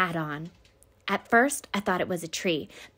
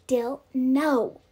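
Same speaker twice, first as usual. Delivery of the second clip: higher, louder pleading "no"; urgent protest; 4 semitones higher, 6 dB louder than usual. A narrator's reading. Child stopping Dill from trying the window, urgent protest. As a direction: stretch the no in alarm.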